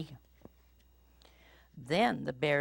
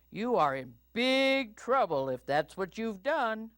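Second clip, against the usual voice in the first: higher scolding, punched BIG; Papa Bear voice; 7 semitones higher, 3 dB louder than usual. A narrator's reading. Papa Bear warns Little Bear of trouble, scolding. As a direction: warn him like a cross father bear. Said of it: "asked Papa Bear".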